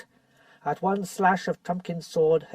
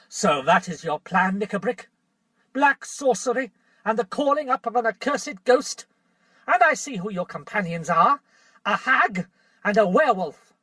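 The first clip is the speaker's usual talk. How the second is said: louder, accusing; young king unmasking the plotters; thunderous king; 5 semitones higher, 5 dB louder than usual. Acted. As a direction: denounce them like thunder. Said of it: "rose the voice of the king like thunder".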